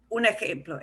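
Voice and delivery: ordinary delivery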